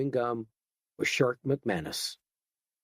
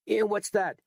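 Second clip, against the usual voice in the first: higher, curt challenge; sharp challenge; 9 semitones higher than usual. Acted. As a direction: snap the question back. Edgar snapping back.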